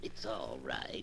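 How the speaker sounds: slow, low stranger. Acted. Huge figure answers slowly.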